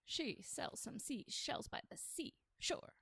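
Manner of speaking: slow whispered tongue twister; slow hushed twister. Shown as a demonstration. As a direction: whisper each word slowly.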